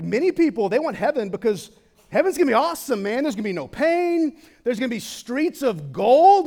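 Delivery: loud, high, fervent sermon build; full-force preaching. Acted. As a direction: preach it out, lifting the crowd. Excited preaching about heaven's joys.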